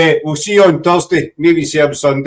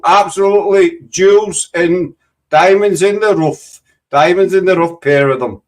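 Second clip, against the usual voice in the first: put-on Yorkshire accent; 3 semitones higher than usual. Host redoing the sign-off for his guests, put-on Yorkshire accent. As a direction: lay on a would-be Nottingham accent that lands in Yorkshire. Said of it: "You sounded like you were from Yorkshire".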